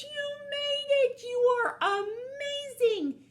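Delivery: very high, loud, stretched-out cheer; over-the-top celebrating wolf; jubilant praise. Acted. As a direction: gush with delight. Wolf celebrates snake's success.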